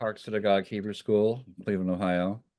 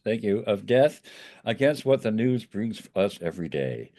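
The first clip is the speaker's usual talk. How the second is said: ordinary talk, nothing marked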